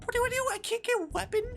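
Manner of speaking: very high, loud squeal; real panic. Real, not acted. Player unable to get a weapon, high panicked squeal. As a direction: squeal it in helpless alarm.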